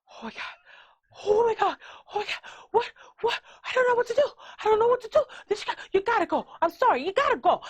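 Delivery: high-pitched imitation of a panicking woman; mock hysterical pleading in a high voice; losing her mind. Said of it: "she's losing her fucking mind".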